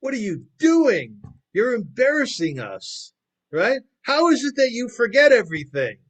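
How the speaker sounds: a husband publicly berating his wife in a loud, high voice; angry yelling. Acted. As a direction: yell at her as if she's hopeless. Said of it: "starts to yell at her".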